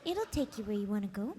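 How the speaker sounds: soft, timid cartoon GPS voice; Fluttershy. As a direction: give directions shyly and hesitantly. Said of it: "I like the Fluttershy one you did".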